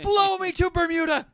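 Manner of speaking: high, loud squawked Merlin cry; Merlin's outburst. Retold or imitated. To the co-host, imitating Merlin's angry exit shout. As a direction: blast it out like the furious old wizard. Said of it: "that's what I have".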